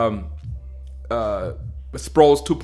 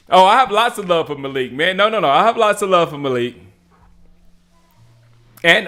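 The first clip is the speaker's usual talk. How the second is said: normal talking voice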